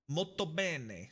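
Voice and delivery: slow, drawn-out mock Italian; hammed-up Italian flourish; Italian accent. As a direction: savor it in a stagey Italian accent. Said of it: "as the Italians would say".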